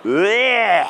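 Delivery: drawn-out comic sound of revulsion; exaggerated "blech" for laughs; mock disgusted "blech". Speaker reacting to his picture on screen, mock disgust.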